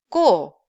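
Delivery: "ko" short and loud, no puff; hard Korean tense "ko". Shown as a demonstration. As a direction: pop out "ko" with a tight k. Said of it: "let's just read each sound".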